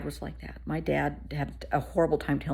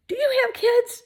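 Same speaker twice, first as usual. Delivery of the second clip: lightly voiced small child, pitched high; little boy voice; 5 dB louder than usual. Imitating the little boy asking the speaker a question. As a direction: ask it like a curious little kid.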